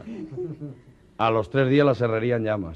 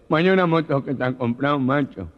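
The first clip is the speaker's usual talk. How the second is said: gangoso; 3 semitones higher, 4 dB louder than usual. Acted. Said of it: "Llega un gangoso".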